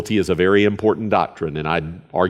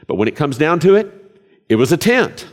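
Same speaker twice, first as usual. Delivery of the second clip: raised emphatic punchline; 7 semitones higher, 4 dB louder, faster than usual. Real, not acted. Punchline about the tabernacle.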